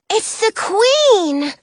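high, drawn-out excited cry; exclaiming. A narrator's reading. Kid Bear spots the arriving queen, exclaiming. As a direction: exclaim it slowly in high-pitched awe. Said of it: "Kid Bear exclaims".

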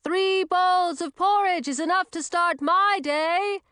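high, slow, booming voice; high-pitched hearty character bellow; loud witch. A narrator's reading. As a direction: holler it high, stretching each word. Loud witch declines breakfast.

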